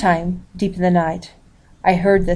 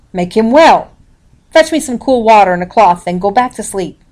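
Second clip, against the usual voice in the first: sharp orders; 3 semitones higher, 6 dB louder than usual. A narrator's reading. Girl gives sharp orders.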